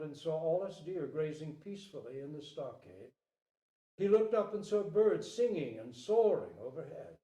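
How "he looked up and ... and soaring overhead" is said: usual speaking voice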